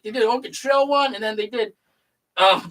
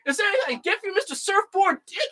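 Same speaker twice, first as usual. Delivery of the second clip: bartender voice; 9 semitones higher, 5 dB quieter, faster than usual. Retold or imitated. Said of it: "The bartender's like".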